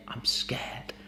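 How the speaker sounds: hushed whisper building into soft voice; acted fearful confession, whispered then voiced; scared thought spoken out. Shown as a demonstration. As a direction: start barely audible, gradually find your voice. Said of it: "you start speaking that thought out".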